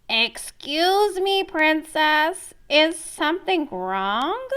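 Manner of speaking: high, slow frog voice, a lightly voiced character; raspy frog voice. Teacher voicing the frog calling to the princess, high and slow. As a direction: call out gently as the frog. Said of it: "a raspy voice called out".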